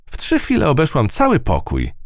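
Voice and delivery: high, satisfied mouse voice; proud of a quick job; high self-satisfied mouse; pleased with herself. A narrator's reading. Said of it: "z zadowoleniem".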